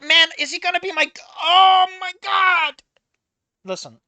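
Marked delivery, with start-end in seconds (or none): 0.0-2.8 high, loud imitation of a clueless friend; hammed-up impression of a clueless friend; mock oblivious reply. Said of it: "And you're like".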